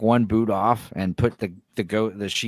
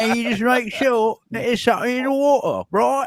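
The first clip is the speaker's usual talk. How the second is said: higher put-on British accent; mock British accent; 10 semitones higher than usual. Shown as a demonstration. Showing friends how to talk British, mock accent. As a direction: demo the lip-locked British way. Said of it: "you just talk like this".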